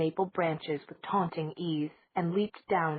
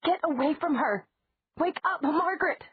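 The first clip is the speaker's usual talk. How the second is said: shouting; 11 semitones higher than usual. Acted. Elena bangs on the window shouting at the kitten, high desperate yell. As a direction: scream at the cat, then at the child.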